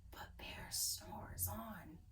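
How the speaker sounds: hushed whisper of the refrain. A narrator's reading. Reading to kids, whispering that bear sleeps. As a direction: whisper so the bear stays asleep.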